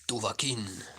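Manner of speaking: ordinary talk, nothing marked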